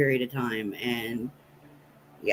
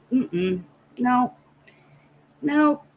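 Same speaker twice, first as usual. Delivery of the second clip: firm no; 10 semitones higher, 3 dB louder, much slower than usual. Real, not acted. Refusing a pet snake.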